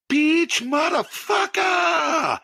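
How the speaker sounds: put-on screamed, high, drawn-out African accent; African-accented caller shrieking down the phone; thick African English accent. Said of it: "in the thickest African English accent".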